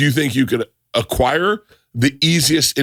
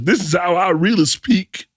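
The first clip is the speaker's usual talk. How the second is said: high, slow mock Indian accent; badly faked Indian accent, drawn out; 8 semitones higher than usual. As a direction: put on a clumsy Indian accent. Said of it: "he did an Indian accent".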